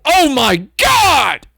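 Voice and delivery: high, slow shocked scream; shocked shout. Retold or imitated. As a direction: cry out in horror. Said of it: "I heard someone go".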